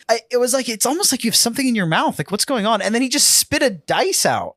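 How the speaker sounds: high-pitched, laughing exasperation; amused disbelief. Real, not acted. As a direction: recount it in a high, incredulous rush. Amused outburst at player's prank.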